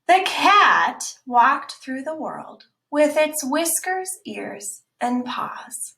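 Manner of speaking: slow, expressive storybook read; slow read-aloud for the class; reading to children. Acted. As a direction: read it out like a picture book for kids. Reading picture book aloud.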